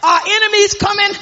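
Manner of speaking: rising, shouted call-and-response sermon; fired-up gospel preacher; preaching at full force. Acted. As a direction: whip up the church, voice climbing.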